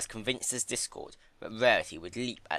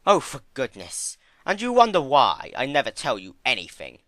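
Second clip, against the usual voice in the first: louder, put-upon Discord; exasperated Discord; 5 semitones higher, 7 dB louder than usual. Acted. Discord grumbling at Fluttershy, exasperated and louder. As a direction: huff it out, fed up.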